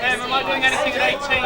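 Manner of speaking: fast, high call to the pit; trading floor shouting. Real, not acted. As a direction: rattle off the question quickly. Trader calling out in the pit.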